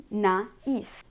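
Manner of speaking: naïf pulled apart as "na... eef"; two syllables. Shown as a demonstration. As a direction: say naïf with the vowels separated. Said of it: "make them two different syllables".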